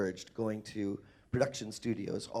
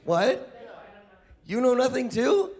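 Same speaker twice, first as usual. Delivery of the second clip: much higher and louder, rising in exaggerated disbelief; mock disbelief; 12 semitones higher, 7 dB louder than usual. Panelist teasing another fan, exaggerated disbelief. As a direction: ham up the shock.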